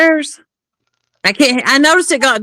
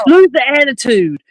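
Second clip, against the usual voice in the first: mock officer's command, pitched up; retold stern order; 4 semitones higher than usual. Officer orders the driver to drop the attitude, retold stern order. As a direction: imitate a cop's sharp order, lightly.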